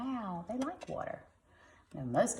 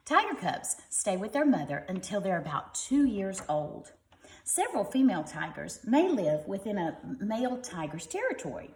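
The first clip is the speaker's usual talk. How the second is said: lively, bright storybook reading; reading to children; 3 semitones higher, 3 dB louder than usual. Acted. Parent reading a tiger book to kids, lively storybook voice. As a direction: read it like a bedtime picture book.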